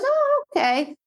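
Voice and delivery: squeaky high pet voice, light and eager; high dog voice. Retold or imitated. Trainer voicing her dog's eager reply, high and squeaky. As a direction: voice the dog like a cartoon pup.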